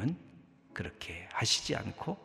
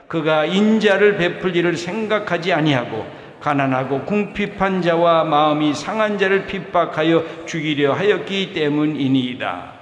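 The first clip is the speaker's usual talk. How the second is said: forceful, louder reading of the psalm; loud scripture reading; 4 semitones higher, 10 dB louder than usual. Acted. Pastor reading Psalm 109 aloud to the congregation, forceful. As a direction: read the curse verses out with full force.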